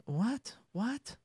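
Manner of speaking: normal talking voice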